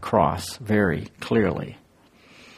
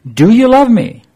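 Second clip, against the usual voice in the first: earnest pleading question; 11 semitones higher, 8 dB louder than usual. Acted. Jesus asks Peter, voiced quote.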